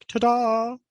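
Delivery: high, drawn-out mock fanfare; showman's ta-da. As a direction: sing out a hammed-up ta-da. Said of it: "puts his hand up like".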